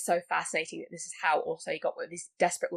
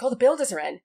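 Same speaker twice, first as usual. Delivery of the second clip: higher and louder, flustered excuse; mock panic; 6 semitones higher, 7 dB louder than usual. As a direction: blurt it out like a caught man. Panicked killer's excuse, acted quote.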